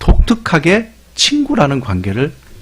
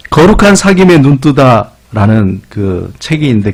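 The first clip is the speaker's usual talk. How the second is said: usual speaking voice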